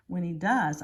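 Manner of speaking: ordinary delivery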